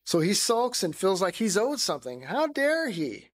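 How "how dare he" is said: high, slow, offended "how dare he"; indignant. Acted. Self-righteous older son resenting his brother, slow and indignant. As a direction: stretch it out in wounded pride.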